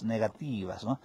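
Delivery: ordinary talk, nothing marked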